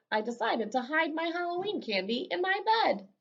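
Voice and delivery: usual speaking voice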